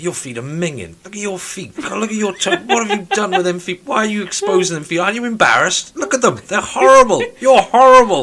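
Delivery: berating a coworker about his bare feet; mock disgusted telling-off. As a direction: scold with theatrical disgust.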